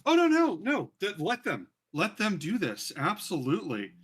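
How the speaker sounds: high, loud burst, easing down; booming unseen voice. Acted. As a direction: cut in loudly from above, then stretch it out. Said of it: "this, uh, voice go".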